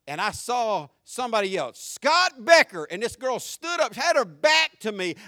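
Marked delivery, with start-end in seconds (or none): 1.8-2.8 louder, higher, drawn-out call. Retold or imitated. Old schoolmate hailing him in Chick-fil-A, calling out. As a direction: call the name across a restaurant. Someone calls out his name.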